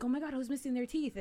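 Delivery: put-on a kid rushing from person to person; mock frantic child; dramatic child alarm. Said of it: "I was a very dramatic child".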